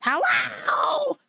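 sudden high spooky falsetto hello; creepy high greeting. Retold or imitated. Haunted house actor creeping up behind, high spooky falsetto. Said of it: "behind me going".

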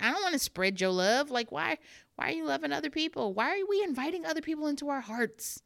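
high, hammed-up jealous protest; mock possessive parent. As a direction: protest like a clingy, wounded parent. Acts possessive parent about kids dating.

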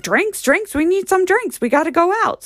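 high, excited call for drinks; rallying dad. Retold or imitated. Daughter mimicking her dad before going out, excitedly rallying. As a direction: imitate Pop eagerly rounding everyone up.

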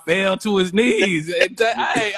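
ordinary delivery

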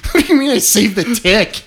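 loud, high, exasperated question; mock incredulous protest. Patient questions doctor's odd request, mock incredulity. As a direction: throw it back loudly in disbelief.

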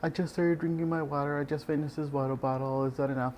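lightly mocking patient impression. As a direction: mimic a clueless patient. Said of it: "people's like".

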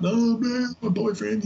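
high-pitched, whiny mock complaint; anxious passenger. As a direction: imitate a stressed-out cab passenger venting. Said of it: "when they get really anxious".

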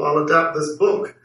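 normal talking voice

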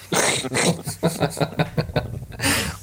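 quiet airy laugh; breathy laugh. Real, not acted. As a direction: laugh under your breath, amused. Laughing at joke on Ruby.

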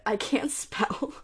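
slow, laughing through the words. Real, not acted. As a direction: giggle as you admit it. Laughs at own illiteracy joke.

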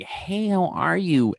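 high, drawn-out gushing hello; slow, high-pitched mock-effusive welcome; open-armed greeting. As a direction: imitate greeting someone with open arms. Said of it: "Kathy was open arms".

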